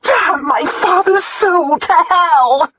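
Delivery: high, loud, drawn-out curse; furious shout. A narrator's reading. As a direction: shout the curse with full force. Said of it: "she shouted".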